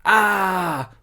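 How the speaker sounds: loud, high, drawn-out cry falling away; annoyed outburst. Shown as a demonstration. Showing what they say when annoyed, drawn-out holler. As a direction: holler each word long and high. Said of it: "what I would normally say if I'm feeling a bit annoyed".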